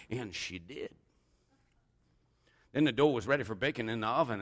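normal talking voice

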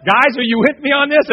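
usual speaking voice

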